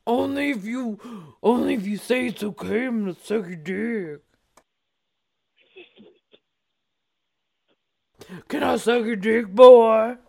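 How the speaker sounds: put-on man voice, ending in a shout; Mister Biggs. Asking the caller's consent, mock man voice. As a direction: proposition him as a mock man. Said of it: "This is Mister Biggs".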